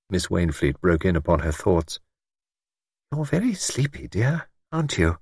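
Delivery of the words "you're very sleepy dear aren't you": soft, kindly asking; gently, like a caring friend; gentle everyday tone. A narrator's reading. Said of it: "said in a gentle, everyday tone".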